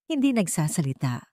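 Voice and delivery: ordinary delivery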